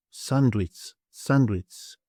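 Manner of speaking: "sandwich" blended as Greek "sanduits"; Greek pronunciation. Shown as a demonstration. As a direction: say "sandwich" with a ts ending, san-duits. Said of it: "So we have".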